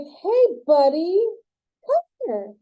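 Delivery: drawn-out mock greeting to a friend; calling him over.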